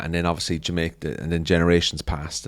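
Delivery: usual speaking voice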